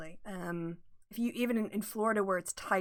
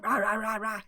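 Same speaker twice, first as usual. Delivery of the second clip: mock angry German; 4 dB louder than usual. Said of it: "An angry German accent?".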